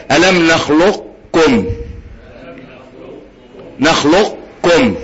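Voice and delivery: drawn-out "نخلقكم" as nakhloq-kum; incomplete idgham. Shown as a demonstration. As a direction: model slowly, heavy qaf sliding into kaf in "نخلقكم". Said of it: "بس إدغام ناقص".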